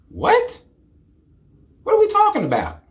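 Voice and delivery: very high, fast disbelief; high shocked what; incredulous. Real, not acted. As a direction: shriek in disbelief. Incredulous reaction.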